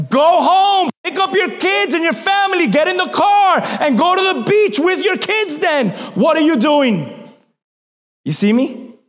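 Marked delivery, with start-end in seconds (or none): 0.1-5.9 higher, forceful preacher's rebuke; exasperated scolding. Acted. Preacher telling off the social media complainer, exasperated. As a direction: scold them with mounting exasperation.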